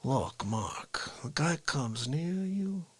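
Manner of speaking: soft, slow Yonkers tough-guy talk; Yonkers accent. Retold or imitated. Cousin advising sixteen-year-old Mark on fighting, soft Yonkers accent. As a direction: imitate a cousin's funny New York accent, hushed and slow. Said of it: "my cousin had that accent".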